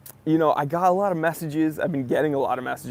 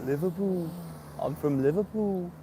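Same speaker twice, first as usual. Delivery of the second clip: playful, badly faked Liverpool accent, pitched up; attempted Liverpool accent; 4 semitones higher, 4 dB quieter than usual. Host greeting a viewer from Liverpool, mock Liverpool accent. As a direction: have a go at a Liverpool accent, higher than your own. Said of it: "I can't do the accent".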